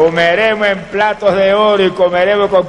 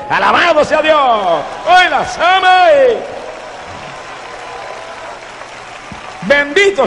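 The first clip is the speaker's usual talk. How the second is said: praise shout; 7 semitones higher than usual. Real, not acted. Preacher exclaims praise. (gritos de alabanza altos)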